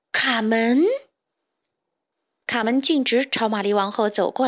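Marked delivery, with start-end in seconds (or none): 0.1-0.9 mild exclamation of surprise; astonished cry. A narrator's reading. Two friends see Carmen drop in, mildly astonished cry. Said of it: "齐声惊呼".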